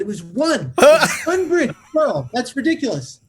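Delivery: high, loud defensive correction; acted outraged quibble; indignant protest. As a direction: protest the exaggeration, half laughing. Narrator protests the accusation, acted.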